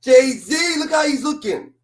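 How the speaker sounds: excited shout, high and loud; calling out. Real, not acted. Streamer shouting at [name] on screen, excited loud call. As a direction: yell his name at the screen.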